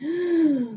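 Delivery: long, soft breath out; quiet deep exhale. Shown as a demonstration. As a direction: breathe out slowly for everyone. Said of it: "Do another one with me".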